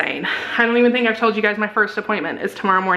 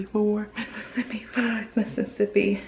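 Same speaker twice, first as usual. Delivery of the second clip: whispered count; 7 dB quieter than usual. Real, not acted. Nervous countdown for test.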